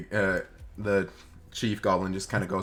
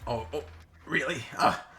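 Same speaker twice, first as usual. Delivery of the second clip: gloating goblin; 3 semitones higher than usual. Acted. Goblin gloats as captive offers information.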